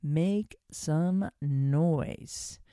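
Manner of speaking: very slow, stretched name of the exercise; drawn-out title. Shown as a demonstration. Host naming her exercise to listeners, slow and stretched. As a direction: savour each word slowly. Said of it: "I call it".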